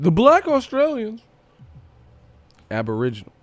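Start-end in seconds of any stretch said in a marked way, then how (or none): 0.0-1.2 much higher, loud, dramatic; friend's line hammed up high and loud; mock announcer cry. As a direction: call it out like a big reveal. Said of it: "He said".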